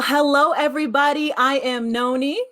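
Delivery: ordinary talk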